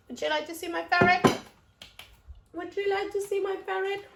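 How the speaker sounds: bursting to show the ferret; excited. Real, not acted. Vlogger eagerly offering viewers a look at her ferret, excited. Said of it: "teasing viewers".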